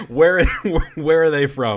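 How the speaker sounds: laughing through the question. Real, not acted. Amused by the mystery accent, asking through real laughter. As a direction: ask it while cracking up.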